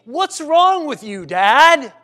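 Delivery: a hint of a rude teenage son; insolent son. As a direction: throw it at your father with contempt.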